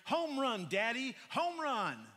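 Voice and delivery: put-on child's proud high shout; declaring excitedly. Little son declaring his home run to dad, excited and loud. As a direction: proudly announce it like a little boy. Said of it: "looking up at me declaring".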